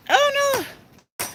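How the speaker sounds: very high, drawn-out little wail; dismayed exclamation. Real, not acted. Gardener interrupts her trellis tour, high-pitched dismay. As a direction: let dismay shoot the pitch right up. Sudden mishap while showing garden.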